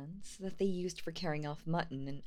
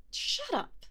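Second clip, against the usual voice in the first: much higher, snapping; high squeaky troll. Acted. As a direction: snap it in a silly high voice. Trolls quarrel.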